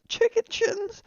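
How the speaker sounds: very high outraged squeak on CHICKEN SHINS; squeaky, scandalised echo; incredulous squeal. Acted. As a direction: shoot the pitch right up in mock disbelief. Repeats insult in outraged disbelief.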